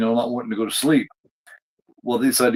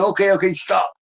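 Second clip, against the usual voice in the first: mock call to stop; 8 semitones higher, 3 dB louder than usual. Stopping the masked yeller.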